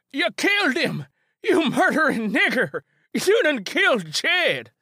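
high, loud hysterical shriek; old Ben shrieks over fallen Jed. Acted. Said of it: "Old Ben shrieked".

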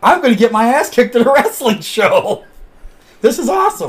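high, loud, laughing through it; laughing disbelief. Retold or imitated. Retelling fear of a beating at the show, laughing. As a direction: giggle at your own doom. Retold inner thought, laughing.

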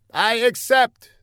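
high, pompous put-on foreign voice; a self-important spy explaining his mistake; high comic foreigner. A narrator's reading. Older man explains to Fla, high and pompous.

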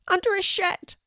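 high cry from a stray cat; a high cry of answer. Acted. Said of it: "cries another".

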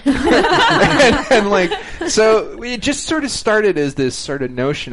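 laughing through the words; real laughter spilling into the telling; giggling as he talks. Real, not acted. Laughing while telling the story.

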